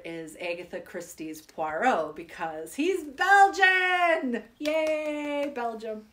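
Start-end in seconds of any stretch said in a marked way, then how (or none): 2.8-6.0 drawn-out, high, gleeful he's Belgian; delighted. Real, not acted. Telling viewers her favourite detective, thrilled. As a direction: savour the word with delight.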